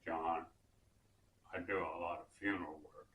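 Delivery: low-pitched imitation of his friend's US Deep South accent; Willie's accent. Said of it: "in that wonderful accent of his".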